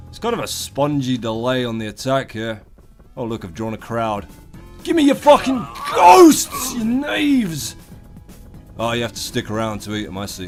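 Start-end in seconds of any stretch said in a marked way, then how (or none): 4.8-7.7 high, loud, slow villain roar. Acted. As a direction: shout down at the knaves like a tyrant. Roaring at a crowd of enemies.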